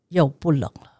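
ordinary delivery

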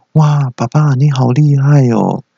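dad mimicking his little son's wonder. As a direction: play the small boy, slowly impressed. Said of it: "然后儿子就说".

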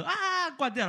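flustered squeal as if in an anime; anime girl voice. Retold or imitated. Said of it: "动漫的". (动漫少女式尖声惊叫)

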